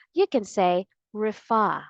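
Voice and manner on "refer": "refer" as rih-FAH, final r dropped; British pronunciation. Shown as a demonstration. Teacher offering learners the British option, "refer" as rih-FAH. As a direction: stress an open FAH ending in "refer". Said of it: "if you like the British English pronunciation better".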